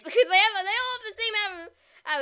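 laughing loudly mid-sentence. Real, not acted. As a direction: talk through the giggles. Joking about triplets.

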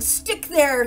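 loud, high burst of temper; angry yell. A narrator's reading. Baker flinging dough at the ceiling, yelling angrily. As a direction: yell it in a fit of anger. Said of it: "he yelled at the dough".

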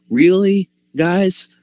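mock exasperation at the church; incredulous, slowing at the end; astonished disbelief. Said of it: "he might be saying".

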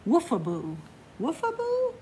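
woofaboo swooping way up, playful; playful high woofaboo. Pretend. Reader teasing the young listener, high playful woofaboo. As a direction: tease the child with a high questioning woofaboo. Playful sing-song to a child.

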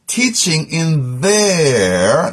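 THEIR held long with a rising end; slow right down and dwell on THEIR; stress on THEIR. Real, not acted. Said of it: "emphasizing the word there".

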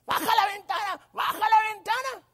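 high-pitched insistent granny voice; shrill old lady. Retold or imitated. Old lady at the car door demands entry, shrill mock cry. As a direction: squawk the order like a tiny old woman.

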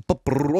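pigeon coo with a rolled Spanish r; comic trilled pigeon call; Spanish-sounding pigeon coo. Acted. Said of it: "este sonido de pombo fica espanholado".

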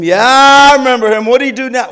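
loud, high, exasperated boss; annoyed Pharisee. Retold or imitated. Pharisee to his servant, loud and exasperated. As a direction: bark out the question, fed up.

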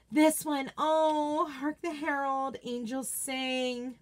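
usual speaking voice

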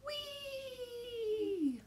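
high, soft, gliding squeal of delight; gleeful whee. A narrator's reading. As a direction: let it swoop down like a vine swing. Swinging down on a vine.

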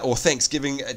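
usual speaking voice

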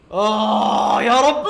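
long drawn-out loud cry to God; slow loud groan of despair; loud desperate plea. Real, not acted. Desperate moment in fight.

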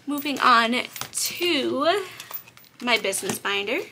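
ordinary talk

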